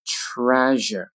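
"Treasure" slowly with a held zh; slow careful "Treasure"; drawn-out model. Shown as a demonstration. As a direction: stretch "Treasure" out for learners. Teacher models word slowly, then normally.

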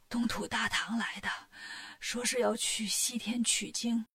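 a frail ruler with no strength left; feeble king. A narrator's reading. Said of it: "说话都没力气".

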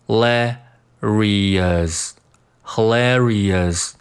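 "hilarious" very slow, each syllable sounded out; every syllable. Shown as a demonstration. Teacher drilling learners, slow syllable-by-syllable "hilarious". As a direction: break "hilarious" into hi-la-ri-ous. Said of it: "不要把音阶读少了".

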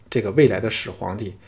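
ordinary delivery, nothing marked

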